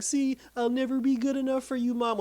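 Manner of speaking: mock-upset high-pitched whine; upset son. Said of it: "he gets upset".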